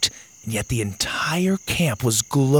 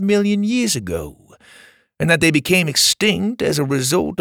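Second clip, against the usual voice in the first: a storyteller leader's raised voice; wise camp leader; 5 semitones higher than usual. Acted. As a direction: speak like a kindly mysterious guide. Pa explains the camp.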